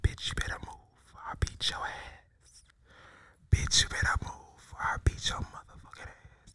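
threatening whispered warning; hostile whisper. Shown as a demonstration. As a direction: hiss the threat under your breath. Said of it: "That's how hostile I'm talking".

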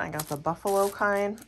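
ordinary talk, nothing marked

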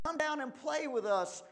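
high, loud playful call. A narrator's reading. Second pig inviting his brother to play, calling out. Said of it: "he called".

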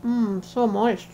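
talking through a mouthful of cake; words round a bite of food; mouth full. Real, not acted. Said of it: "Clearly I talk with my mouth full".

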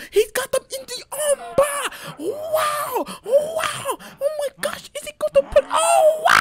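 shrieked, very high, very loud cheering; screaming hype. Acted. Gamer shrieking at his own armbar, wild falsetto hype. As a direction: scream it like a crazed ringside fan.

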